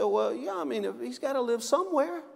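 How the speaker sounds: lightly voiced wife giving in with a shrug; wife's shrug. Wife concedes in joke.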